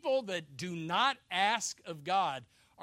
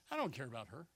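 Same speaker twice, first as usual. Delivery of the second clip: low, offhand dismissal; acted couldn't-care-less; mock indifference; 6 semitones lower, 6 dB quieter, faster than usual. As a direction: drop it lower and softer, as if over her. Next day, the opposite extreme.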